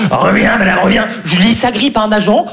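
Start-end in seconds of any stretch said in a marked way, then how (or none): 0.1-1.1 distorted put-on monster call; hunchback creature. Boyfriend as hunchback pursues woman in metro, distorted creature voice. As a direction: play the hunchback calling her back. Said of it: "le bossu, boiteux et borgne".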